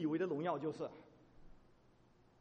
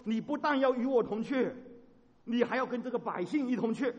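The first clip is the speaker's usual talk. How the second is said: loud and urgent pleading; earnest plea; 8 semitones higher, 7 dB louder than usual. Acted. 摩西恳求神与百姓同去，大声恳切. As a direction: press the request home. Moses begs God again.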